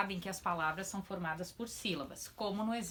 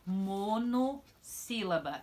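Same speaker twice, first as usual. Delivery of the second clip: slow term; much slower than usual. Shown as a demonstration. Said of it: "teacher stresses new term".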